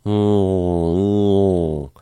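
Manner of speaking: nasal hum with the tongue pulled down; nasal hum stretching down. Shown as a demonstration. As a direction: hum through the nose and drop the tongue root. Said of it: "so we're gonna go".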